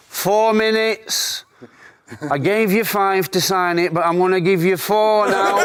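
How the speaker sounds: high, slow teasing gaffer voice; mock Clough countdown. Retelling Clough's signing deadline, slow teasing mock gaffer. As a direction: count it down like the bullying boss.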